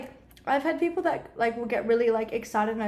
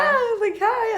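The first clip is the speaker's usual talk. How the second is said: awkward hi; 10 semitones higher, 4 dB louder, much slower than usual. Retold or imitated. Awkward greeting to fan.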